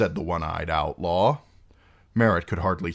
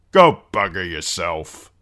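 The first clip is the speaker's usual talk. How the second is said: slow, louder rebuff; brusque; 7 dB louder than usual. A narrator's reading. Big outlaw refusing the singer, brusque. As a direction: dismiss him brusquely. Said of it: "the big outlaw replied brusquely".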